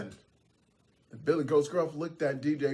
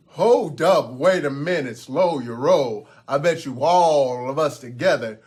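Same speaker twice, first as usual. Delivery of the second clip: big goat's challenge; 5 dB louder than usual. Acted. Grande Gruff bets the troll they can win, loud challenge. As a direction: halt him firmly, drawing out the words.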